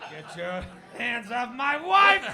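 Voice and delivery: slow, loud gorilla roar voice; jealous gorilla. Pretend. Host voices the gorilla warning off the guest, slow and bellowing. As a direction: bellow like a jealous ape guarding his mate.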